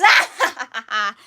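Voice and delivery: loud stage villain cackle; evil laugh. Acted. Pirate capping the theater quote, loud attempted evil laugh. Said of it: "Can't do the evil laugh right now".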